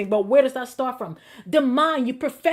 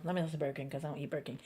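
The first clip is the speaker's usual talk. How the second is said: hushed aside; 9 semitones lower, 17 dB quieter, much faster than usual. Real, not acted. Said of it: "aside, correcting himself".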